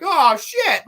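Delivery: high, loud shocked exclamation. Retold or imitated. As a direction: blurt it out in shock. Prostitute finds no genitals.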